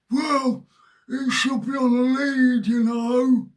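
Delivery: high, loud mock yell of a rule-obsessed old man; high hammed-up telling-off; scolding old man. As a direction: shout it as the fussy old geezer. Mocking the old man's rule scolding.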